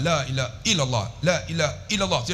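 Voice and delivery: "la ilaha illallah" run through short, vowels not held; careless quick zikir. Shown as a demonstration. Preacher showing how many people say it, "la ilaha illallah" short and careless. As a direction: rattle off "la ilaha illallah" without lengthening. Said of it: "Banyak kita".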